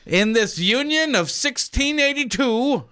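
high, slow, hammy colonial voice; old-timey gentleman. Pretend. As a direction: play a stuffy man from centuries past. Joke about old-fashioned "union.

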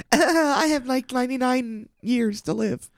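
very high squealed fright; mock panic. Mocking someone reading their death clock, fake panicked. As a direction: gasp it in a silly high voice.

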